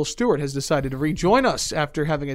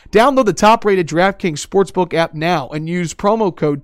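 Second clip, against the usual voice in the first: ad read; 3 semitones higher, 7 dB louder than usual. Acted. Ad read take.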